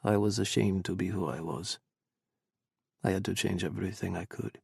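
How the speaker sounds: low, subdued, flattened voice; understated, low confession gone flat; flat and nasal. A narrator's reading. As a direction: let it go flat and a touch nasal. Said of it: "went flat and nasal".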